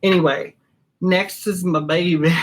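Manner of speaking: usual speaking voice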